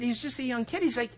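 normal talking voice

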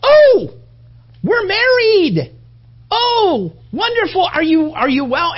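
very high, loud delighted gush; delighted wife. Retold or imitated. Wife discovering she is married, very high delighted gush. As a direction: gush with hammed-up joy, slow at first. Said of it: "and she goes".

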